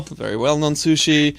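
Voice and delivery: ordinary delivery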